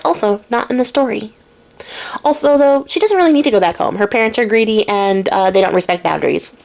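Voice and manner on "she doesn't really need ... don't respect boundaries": normal talking voice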